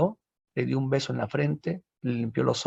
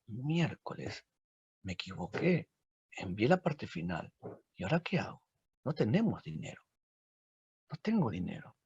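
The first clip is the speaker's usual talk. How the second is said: much softer, low, dismayed; worried murmur; 5 semitones lower, 12 dB quieter than usual. Acted. Author realises he sent the wrong half, soft and worried. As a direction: mutter it like a broke, panicked writer.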